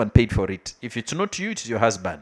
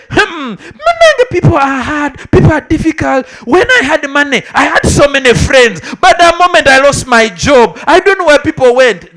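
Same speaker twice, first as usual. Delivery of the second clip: complaining voice; 7 semitones higher, 6 dB louder than usual. Retold or imitated. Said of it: "That is why people say".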